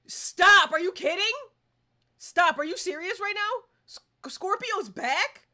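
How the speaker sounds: loud, high excited shouts; thrilled shouting. Real, not acted. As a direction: yell in stunned delight at the news. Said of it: "genuinely excited".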